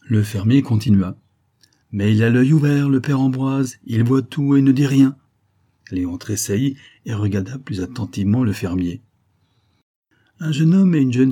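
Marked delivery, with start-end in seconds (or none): 1.9-5.2 louder, pointed farmer's warning; a knowing farmer raising his voice in warning; emphatic warning. Acted. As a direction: lean in and press the point hard. Farmer warns Léon meaningfully.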